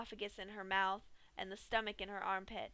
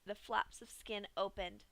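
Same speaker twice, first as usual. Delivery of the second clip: usual speaking voice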